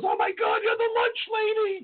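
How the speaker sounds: high-pitched squealing woman; imitated screaming fan. Woman screaming to a TV chef from her car, imitated screaming. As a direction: shriek it like an excited fan. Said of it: "starts screaming out of a window".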